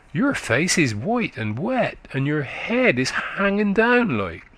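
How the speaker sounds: put-on high, quick childish voice of a country boy; little boy chatting up to a tired woman, high and eager; chattering little boy. A narrator's reading. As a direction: prattle like a small eager lad. Boy observes her.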